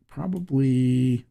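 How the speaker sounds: ordinary talk, nothing marked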